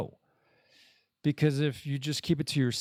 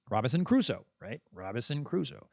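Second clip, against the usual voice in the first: normal talking voice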